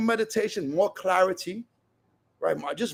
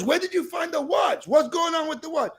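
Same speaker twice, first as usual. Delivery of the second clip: much higher, amazed questions; astonished; 7 semitones higher than usual. Retold or imitated. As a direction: ask in disbelief that she found it. Husband amazed at found watch.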